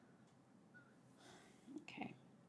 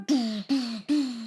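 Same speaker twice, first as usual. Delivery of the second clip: booming mouth-made cannon fire; vocal blast sound effects; imitated gunfire; 4 dB louder than usual. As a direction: imitate repeated shots with your voice. Imitates gunfire after the order.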